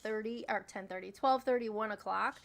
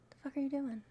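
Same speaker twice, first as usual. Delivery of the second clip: usual speaking voice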